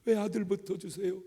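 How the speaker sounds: retold complaint, rising into a mild whine; arguing with God. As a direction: press God with hurt questions. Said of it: "근데 따졌어요".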